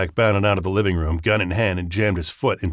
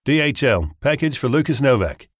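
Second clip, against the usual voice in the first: high-pitched British delivery-driver voice; British accent; 6 semitones higher, 4 dB louder than usual. A narrator's reading. Fake courier announces package at the door, British accent. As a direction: put on your best British accent, pitched up. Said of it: "In my best British accent, I said".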